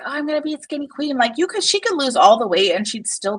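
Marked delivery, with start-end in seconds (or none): 0.0-1.2 higher, fast mock boast; quick high parody; mocking Shani. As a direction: rattle it off high to ridicule her. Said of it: "she's like".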